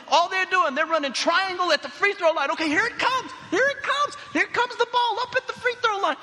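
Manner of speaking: frantic high-pitched courtside yell; shouting. Retold or imitated. Crazy dad calling out the other team's strategy, shouting. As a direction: shout the play as it unfolds, very high and loud. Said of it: "I'm shouting it out on the court".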